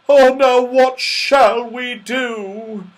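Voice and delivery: loud, high, slow wail; dismayed. A narrator's reading. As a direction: wail it helplessly, drawn out. Bear dismayed at being surrounded.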